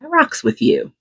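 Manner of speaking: high, playful "I rocks with you"; pitched well up in a playful bro voice; mock slang approval. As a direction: toss it off like a casual compliment. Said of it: "and go".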